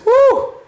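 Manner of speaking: sudden high, loud whoo like a blast of heat; hot-air whoo; high whoo of furnace heat. Retold or imitated. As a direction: let out a squealing whoo at the furnace.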